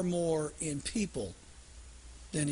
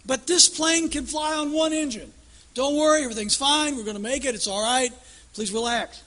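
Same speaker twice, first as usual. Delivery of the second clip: high, loud, fast pleading pilot; hammed-up frantic captain; panicky captain; 7 semitones higher, 7 dB louder than usual. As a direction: beg the passengers not to panic. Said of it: "He says".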